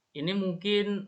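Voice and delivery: normal talking voice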